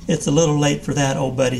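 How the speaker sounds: ordinary delivery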